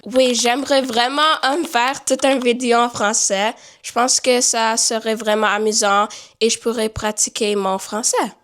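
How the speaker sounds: casual French reply to a viewer; speaking French. Shown as a demonstration. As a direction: switch into French with a light French accent. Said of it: "Can you make a, a vi-, a whole video in French".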